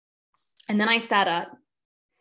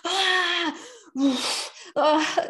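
imitated moaning; 10 semitones higher than usual. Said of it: "literally moaning".

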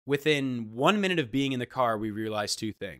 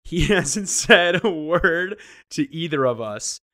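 laughing through the words, slow and louder; can't hold the laugh in; 4 semitones higher, 7 dB louder, slower than usual. Real, not acted. Telling the co-host, laughing in disbelief.

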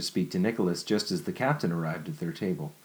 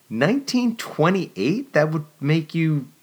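much higher, slow rising Nineteen twenty-eight; incredulous echo; 9 semitones higher, 5 dB louder, slower than usual. Acted. Jennifer echoing Nicholas's birth year, much higher and slow.

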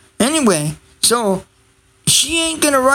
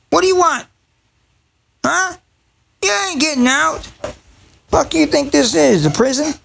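much higher, mocking pet talk; taunting the dog; 7 semitones higher than usual. Real, not acted. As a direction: rib the dog in a high teasing voice.

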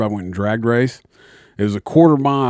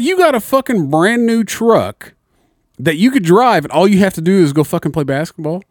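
buddy scolding him in class, mock outraged; retold angry rant; 8 semitones higher, 3 dB louder than usual. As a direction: lay into him like an exasperated friend. Friend chewing him out.

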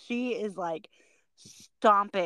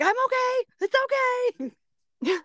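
squeaky, very high "I'm okay" after a tumble; high-pitched reassurance; faster than usual. Retold or imitated. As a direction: reenact a high-voiced all-clear. Reassures startled flag guy after crashing out.

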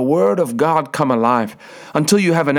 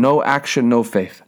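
usual speaking voice

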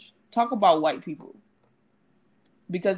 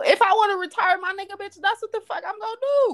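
high-pitched, heated outburst; indignant retort; 11 semitones higher than usual. Acted. Defending retiring a husband to critical TV host, indignant. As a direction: fire back in high-pitched outrage.

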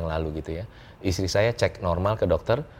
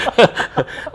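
loud, high burst of laughter; real belly laugh at a joke; hearty laugh; 6 dB louder than usual. Real, not acted. Host and guest joking about his marriage age, hearty laugh.

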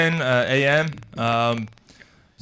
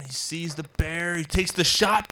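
ordinary delivery

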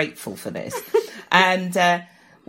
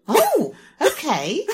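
slow bemused; 10 semitones higher, 5 dB louder, much slower than usual. Retold or imitated.

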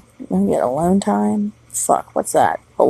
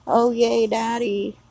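higher-pitched sing-song mock cheer for Daddy; 5 semitones higher, 3 dB quieter than usual. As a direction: lightly mimic a gleeful "yay". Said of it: "And Daddy's like".

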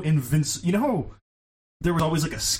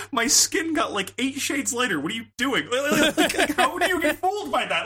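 incredulous mock rant; 11 semitones higher than usual. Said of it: "And it's like".

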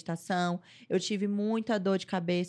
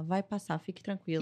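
softer, a lightly voiced calm pediatrician; reassuring doctor; 6 dB quieter than usual. Imitating the pediatrician calming the new mother, softer and gentle. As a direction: soothe her gently, like the doctor did. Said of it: "Tudo que eu falava com ela".

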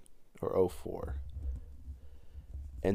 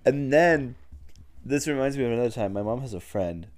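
ordinary talk

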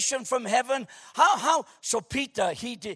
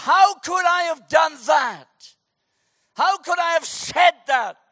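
anguished cry; 6 semitones higher, 8 dB louder than usual. Acted. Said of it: "you think to yourself".

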